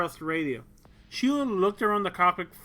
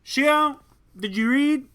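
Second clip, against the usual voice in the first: calling over radio; 7 semitones higher, 7 dB louder, slower than usual. Acted. Susie calls over radio.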